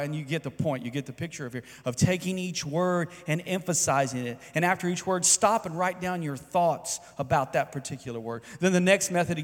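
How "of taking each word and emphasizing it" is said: normal talking voice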